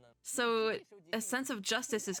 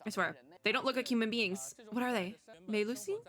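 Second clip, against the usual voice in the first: normal talking voice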